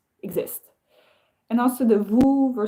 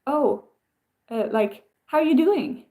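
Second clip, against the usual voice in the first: perky cashier; 6 semitones higher, 3 dB louder than usual. Retold or imitated. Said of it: "people at the cashier in the U S will be like".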